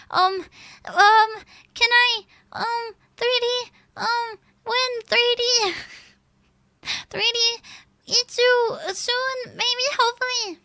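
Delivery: timid pleading. Retold or imitated. Retells pleading with manager.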